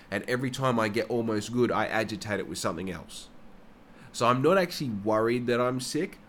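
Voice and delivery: usual speaking voice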